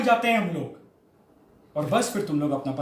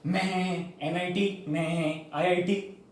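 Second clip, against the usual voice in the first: slow, softer goat-like drone; bleating like goats; 3 semitones lower, 7 dB quieter, much slower than usual. Retold or imitated. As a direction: stretch the first word into a goat's bleat. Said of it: "बकरों की तरह". (बकरे जैसी मिमियाती नकल)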